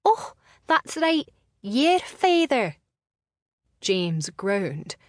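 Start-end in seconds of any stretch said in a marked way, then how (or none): 0.1-2.8 high, loud, feigned realisation; mock pondering. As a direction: pretend it just came to mind. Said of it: "feigning to ponder".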